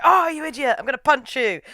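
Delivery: high, loud indignant retort; mock outrage. Sakura snaps back at Shizuka, mock outraged. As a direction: protest loudly in mock anger. Acted comic quarrel, insults.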